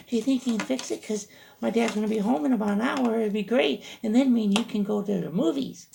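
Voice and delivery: put-on high, eager falsetto girl voice; imitated girlfriend. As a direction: gush in a high put-on girlish voice. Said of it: "and she's like".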